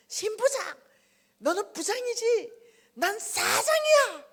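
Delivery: wounded pride yelling I'm the boss; screaming rant. Retold or imitated. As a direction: scream it alone at the altar. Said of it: "강대상에서 난리가 났습니다".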